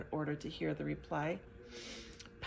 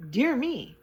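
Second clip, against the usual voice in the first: higher, louder surprised exclaim; light astonishment at a tiny orc; a touch of delighted surprise; 7 semitones higher, 7 dB louder than usual. A narrator's reading. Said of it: "said in surprise".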